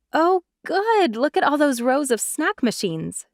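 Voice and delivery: a high, happy "Oh, good!" that settles into excited chatter; thrilled to spot the snacks; delighted. A narrator's reading. Jenna delighted by snack machines.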